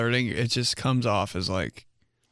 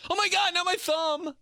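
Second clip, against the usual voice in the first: put-on girl's squeal. Girl voiced, thumb bent.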